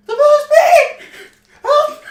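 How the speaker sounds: piercing mock scream, very high and loud; over-the-top falsetto warm-up yell; high-pitched imitation. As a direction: shriek it as high as your voice will go. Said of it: "can't even go as high pitch as he can".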